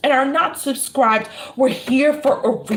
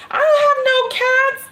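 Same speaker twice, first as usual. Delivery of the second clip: emphatic excited repeat; 12 semitones higher than usual. Real, not acted. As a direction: throw it out high with a laugh. Laughing, repeating her denial.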